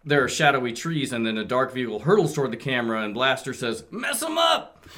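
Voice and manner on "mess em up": high, punchy cartoon yell; battle cry. Reading Blaster's battle cry to friends, high cartoon yell. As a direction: fling it out like a rallying shout.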